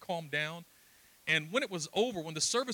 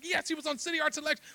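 fast, high, eager agreement; excited confirmation; 11 semitones higher, faster than usual. Retold or imitated. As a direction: confirm it in a thrilled rush. Father's excited confirmation acted out.

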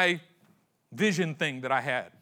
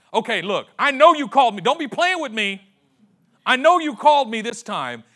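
much louder, higher and pushing hard; fed-up insistence; 7 semitones higher, 10 dB louder than usual. Acted. Samuel to Eli on the third waking, a loud, higher, fed-up insistence. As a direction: insist you won't be played with.

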